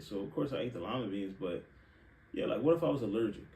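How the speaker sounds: ordinary talk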